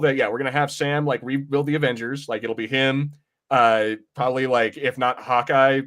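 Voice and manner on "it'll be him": ordinary delivery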